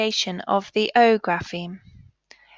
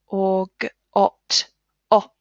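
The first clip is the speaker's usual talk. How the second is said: ordinary talk, nothing marked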